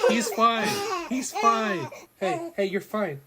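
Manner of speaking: high, gentle reassurance. Real, not acted. Dad calming his crying son, high and gentle.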